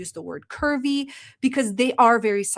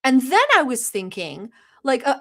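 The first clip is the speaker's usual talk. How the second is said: ordinary talk, nothing marked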